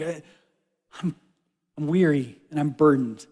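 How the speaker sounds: ordinary delivery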